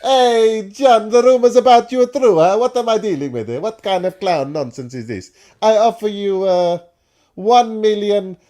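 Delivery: put-on loud, high Italian accent haggling over a fee; mock Italian accent. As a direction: boom it out like a brash Italian director. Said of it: "the Italian accent".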